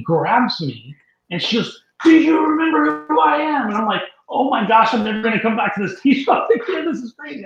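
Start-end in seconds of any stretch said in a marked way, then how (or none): 2.0-3.7 high, loud imitation of an excited woman; hammed-up excited recognition; acted eager stranger. Acted stranger grabbing him, asking. 4.3-7.4 acted dismay. Acted startled reaction to stranger.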